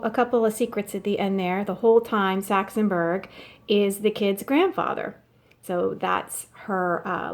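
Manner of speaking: plain reading voice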